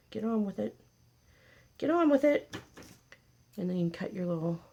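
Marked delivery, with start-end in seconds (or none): 0.1-0.8 a light, higher teasing nudge; mock impatient nudge. Repeats the line, second more urgent. 1.8-2.6 higher pitched, playful exasperation; mock exasperated urging. Repeats the line, second more urgent.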